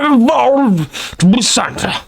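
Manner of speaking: put-on high, slow, grand old gentleman; posh Darwin voice. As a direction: drawl it like a posh Victorian. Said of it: "He'd be like".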